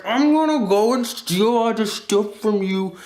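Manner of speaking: high, put-on thief voice; hammed-up boast, pitched well up; mock thief. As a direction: play a cartoonish robber.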